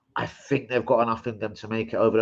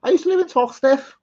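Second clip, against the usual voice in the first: high, hammed-up Scouse voice; put-on Liverpool accent; 14 semitones higher, 6 dB louder than usual. Telling viewers about Toxteth as a joke, put-on Liverpool accent. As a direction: play a Scouser from a rough area, high and loud. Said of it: "Accent sounds more Liverpool".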